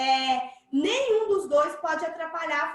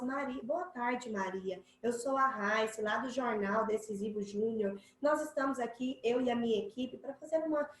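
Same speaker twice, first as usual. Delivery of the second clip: softer, lower, calm formal tone; serious and respectful; 4 semitones lower, 6 dB quieter than usual. Shown as a demonstration. Reporter Raíssa greets sick Maria softly and formally. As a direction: speak gently as a serious reporter. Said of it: "o tom de voz".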